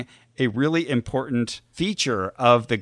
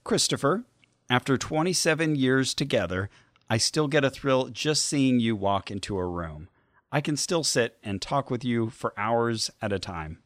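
message read aloud; 5 dB quieter than usual. Acted. Reads a birthday message.

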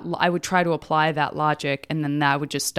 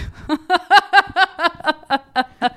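loud high laugh; 12 semitones higher, 6 dB louder than usual. Real, not acted. Laughs at her own question.

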